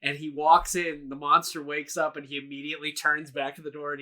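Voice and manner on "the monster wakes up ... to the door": ordinary talk, nothing marked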